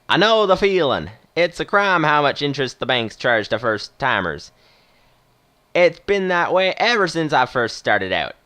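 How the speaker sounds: put-on friendly-game Western movie accent. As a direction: play the retired captain with a Western twist. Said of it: "doing a sort of Western accent".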